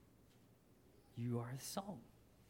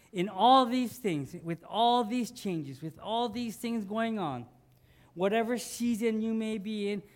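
preaching; 4 semitones higher, 4 dB louder than usual. Acted. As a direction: drive it home to the congregation, building. Preacher builds an urgent exhortation.

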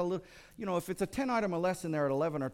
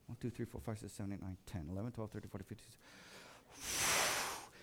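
much softer counting that trails off into a whisper; 6 semitones lower, 12 dB quieter than usual. Retold or imitated. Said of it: "I'm going".

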